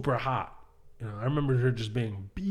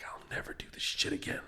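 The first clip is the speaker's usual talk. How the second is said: barely voiced, under the breath; whispered vow; 10 dB quieter than usual. Comic recalling a failed bit, whispered vow. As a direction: whisper it to yourself in defeat.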